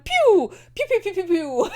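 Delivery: high pew pew gunshot noises; gun noises. Retold or imitated. Retelling the ambush with playful pew gunshot sounds. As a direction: fire off toy-gun sounds.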